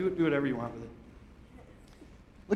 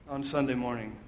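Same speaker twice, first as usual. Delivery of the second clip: ordinary talk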